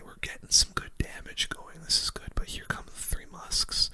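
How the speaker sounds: ordinary talk, nothing marked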